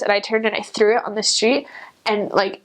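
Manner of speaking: normal talking voice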